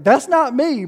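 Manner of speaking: high, loud objection; indignant protest. Acted. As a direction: protest your innocence loudly. Voicing a hacked person's protest.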